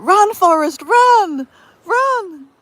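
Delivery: high, loud mock urging; film-quote shout. Joking to Miss Paula about running, hammed-up calling out. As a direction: playfully holler it like the movie line. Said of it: "It'd be like".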